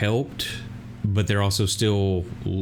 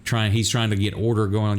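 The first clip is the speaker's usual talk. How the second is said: ordinary delivery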